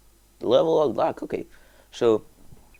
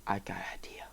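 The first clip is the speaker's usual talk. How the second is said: scheming whisper; 3 semitones lower, 15 dB quieter, faster than usual. Pretend. Gamer whispering a sneaky plan to viewers.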